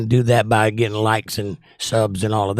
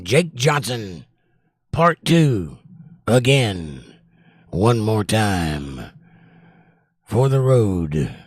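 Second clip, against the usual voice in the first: trailer voice; 3 semitones lower, much slower than usual. Pretend. Dramatic trailer-style self intro.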